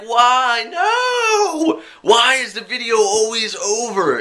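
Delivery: high, hammed-up wailing No; mock despair. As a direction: wail like a fan whose show just ended. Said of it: "you're like".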